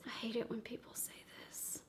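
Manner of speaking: real whisper, quick and private; whispered aside. Real, not acted. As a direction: slip it to the audience under your breath. Aside about her own words.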